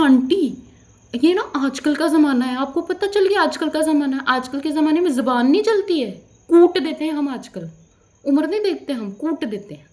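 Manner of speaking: re-enacted angry comeback; heated comeback. Acted quarrel answering the old woman.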